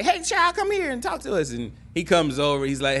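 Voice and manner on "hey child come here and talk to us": high sing-song call, much higher; calling out flirtatiously. Retold or imitated. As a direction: call him over across the yard. Best friend calling the man over.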